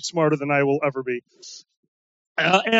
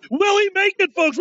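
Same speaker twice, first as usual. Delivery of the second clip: announcer voice; 10 semitones higher than usual. Retold or imitated. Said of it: "he was taking bets".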